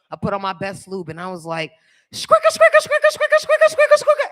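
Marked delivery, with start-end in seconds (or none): 2.1-4.3 very high loud repeated squeak; squeaky squelch noise. Retold or imitated. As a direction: squeal it like a wet squeaky sound. Imitating lube noise.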